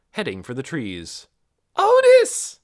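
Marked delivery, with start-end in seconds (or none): 1.8-2.6 high, loud call of the name; girl calling her dog; shout. Acted. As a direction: call out across the field. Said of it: "Nikki shouted".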